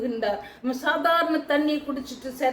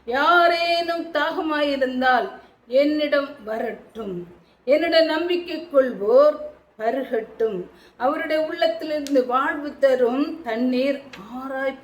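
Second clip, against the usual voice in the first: loud proclamation; 3 dB louder than usual. Acted. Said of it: "உரத்த குரல்ல".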